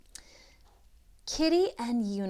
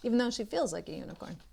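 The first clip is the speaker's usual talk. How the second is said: ordinary delivery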